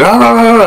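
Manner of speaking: high, run-together mock barks; imitated dog barking. Roommate's dog greeting vlogger on the stairs, imitated barking. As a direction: mimic a big dog barking. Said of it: "barking every time I come up".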